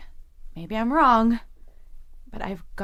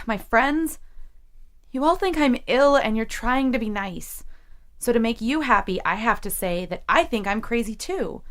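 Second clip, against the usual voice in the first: rising furious rant, louder and higher; angry outburst; 3 semitones higher, 4 dB louder than usual. Acted. Jessie lashing out at her psychiatrist, building fury. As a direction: let the anger build until it cracks.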